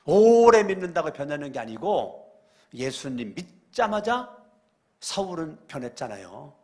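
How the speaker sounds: normal talking voice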